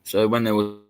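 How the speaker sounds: normal talking voice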